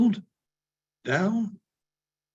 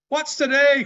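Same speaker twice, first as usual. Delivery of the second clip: much higher, louder call; cried out; 10 semitones higher, 6 dB louder than usual. Acted. Scrooge calling from the window to a boy, loud and high. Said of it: "cried Scrooge".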